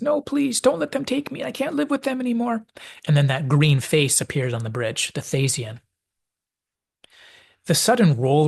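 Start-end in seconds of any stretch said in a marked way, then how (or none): 0.0-2.7 put-on high, strained teenage begging; desperate high-pitched plea from a frightened teen; pleading Charlie. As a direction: beg like a scared boy being taken away. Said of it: "And Charlie says".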